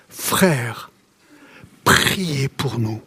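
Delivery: low, slow and soft, reading the verse; quiet pleading verse reading; slow solemn reading. Acted. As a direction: let the plea sink in slowly. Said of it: "il crie son besoin".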